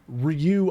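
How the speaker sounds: Ryu said as a single syllable, R, L and Y blended; one-syllable Ryu. Shown as a demonstration. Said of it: "like, one syllable".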